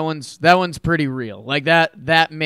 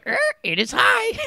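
much higher squawking parrot voice. Shown as a demonstration. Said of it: "I like the parrot voice one".

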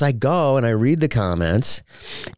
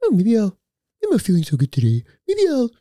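lightly faked sick voice, higher; half-hearted fake-sick whine; mock sickly excuse; 10 semitones higher, faster than usual. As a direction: pretend to feel unwell to dodge. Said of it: "I'm like".

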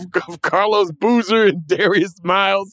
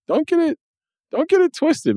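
higher pitched, punchy warning; emphatic high insistence; 10 semitones higher than usual. Real, not acted. Defending the old bench players to his cohost, pitched up. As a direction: set the record straight, pitched up.

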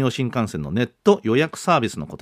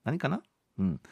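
normal talking voice

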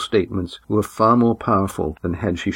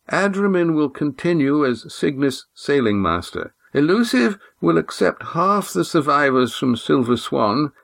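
put-on formal old man; 8 semitones higher than usual. A narrator's reading. Old Oron welcomes the Silver Swan, formal. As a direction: greet her like a stiff elder.

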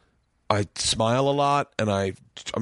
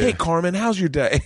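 overly friendly greeting; 8 semitones higher than usual. Retold or imitated.

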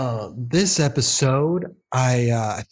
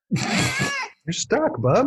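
talking to a pet; much slower than usual. Real, not acted. Cat stuck, high coo.

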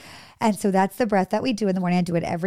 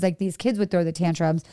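ordinary talk

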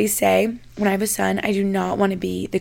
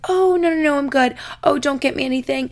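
mock polite refusal; 5 semitones higher than usual. Mimicking girls declining gifts.

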